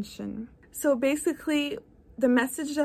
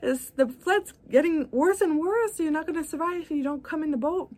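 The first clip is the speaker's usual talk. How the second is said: motorboat rescuer; 6 semitones higher than usual. Retold or imitated. Motorboat guy shouting at the man on the roof, high and urgent. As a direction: yell at him, high and alarmed, to get in. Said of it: "the guy in the motorboat is like".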